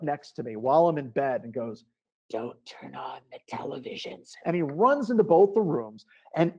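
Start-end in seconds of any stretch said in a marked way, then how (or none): 2.3-4.3 hushed imitation of an urgent warning; whispered warning. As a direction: whisper a warning right up close. Owner warns him in bed.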